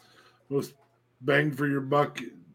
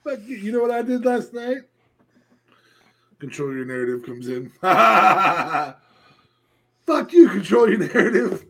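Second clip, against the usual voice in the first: mock taunt, laughing; 8 semitones higher, 5 dB louder than usual. Continuing the mock chant.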